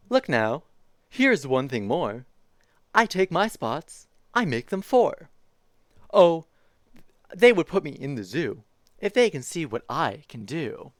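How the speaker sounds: lively picture-book reading voice; reading to children. Acted. Streamer reading a zoo picture book to chat, bouncy storytime voice. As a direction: read it like storytime for little kids.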